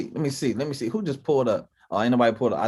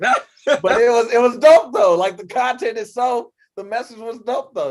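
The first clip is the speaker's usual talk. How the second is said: hyped; 9 semitones higher, 6 dB louder than usual. Real, not acted. Hyped about first episode.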